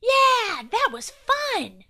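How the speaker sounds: high, loud whoop, easing off; enthusiastic. A narrator's reading. Will after catching the nose, enthusiastic. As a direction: burst out with delight, then settle. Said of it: "said Will enthusiastically".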